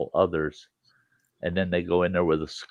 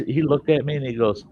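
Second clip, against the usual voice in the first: usual speaking voice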